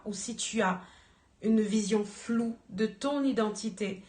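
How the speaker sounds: ordinary delivery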